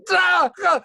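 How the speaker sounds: very high, loud strangled ah ah screams; dying scream. Shown as a demonstration. Voice actor acting out the death noise. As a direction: scream as if being run through. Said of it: "you have to do three noises".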